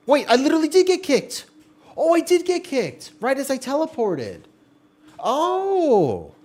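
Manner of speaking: high, loud squeal of dismay; shocked squeal. Real, not acted. Streamer squealing at getting kicked from the game. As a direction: shriek it in disbelief.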